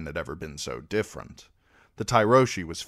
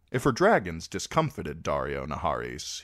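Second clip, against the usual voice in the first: usual speaking voice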